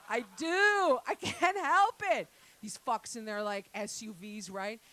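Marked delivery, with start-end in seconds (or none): 0.1-2.3 higher, louder, slower insistence; loud admission. Real, not acted. Comic confessing road rage to the crowd, loud. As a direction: own it out loud.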